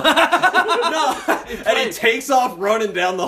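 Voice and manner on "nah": high, loud laughing nah; a hint of a scared kid backing off; lightly voiced classmate refusing; laughing refusal. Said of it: "he's like".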